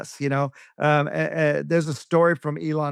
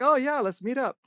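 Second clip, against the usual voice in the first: eager mayor; 8 semitones higher than usual. Retold or imitated. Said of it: "And he's like".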